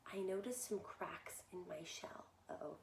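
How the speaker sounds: hushed, a touch of dread; worried whisper. Acted. Egg whispering to children about his cracked shell. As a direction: whisper the bad news.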